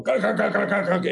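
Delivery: quick guttural stutter of rotor noise; hovering helicopter imitation. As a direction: imitate blades hovering close by.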